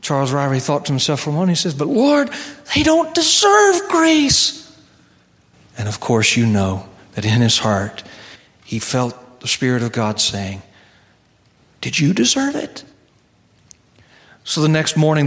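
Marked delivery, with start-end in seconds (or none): 1.7-4.7 high, slow pleading protest; whiny complaint. Acted. Seminarian protesting to God, high and whiny. 11.8-12.8 higher, distant questioning voice; voice of God. Acted. Spirit of God answering the seminarian, distant gentle question.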